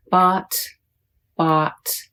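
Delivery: "Bot" with an open ah vowel; soft O. Shown as a demonstration. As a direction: open your mouth on "Bot": ah. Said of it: "Open your mouth for the sound".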